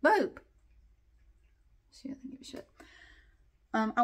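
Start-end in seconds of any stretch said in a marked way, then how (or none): 0.0-0.4 high call to the cat. Real, not acted. Calling the cat, high call. 1.9-2.7 a quick whispered aside. Real, not acted. Aside about the indifferent cat, whispered.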